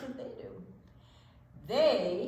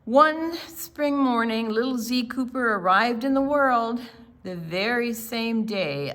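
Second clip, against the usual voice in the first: lively storybook read-aloud; picture book read to a group of children; 6 dB louder than usual. Acted. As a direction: read it like storytime for little kids.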